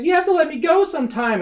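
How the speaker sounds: high-pitched put-on complaint; mock pleading. Younger sister to overprotective sister, mock pleading.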